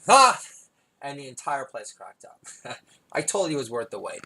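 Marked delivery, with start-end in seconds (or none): 0.1-0.4 loud high ha. Real, not acted. Punchline triumphant laugh.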